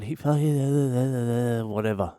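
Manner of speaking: slow, strained hint of hysterics; mock hysterical girlfriend. Girlfriend back at the bar, upset: mock hysterical. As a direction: lightly imitate her sobbing rant. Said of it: "she's fucking hysterical".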